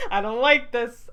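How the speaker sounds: loud laughing repeat. Real, not acted. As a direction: say it again through laughter.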